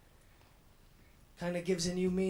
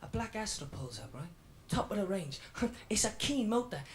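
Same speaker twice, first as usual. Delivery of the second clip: usual speaking voice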